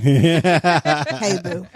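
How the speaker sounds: laughing seductive greeting; sultry voice. Acted. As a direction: play the seductress through a laugh. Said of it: "As I try to seduce my future husband".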